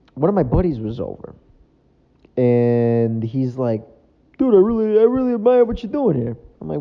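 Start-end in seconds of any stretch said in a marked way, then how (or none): 4.4-6.4 buddy's voice. Retold or imitated. Buddy praising, voiced high.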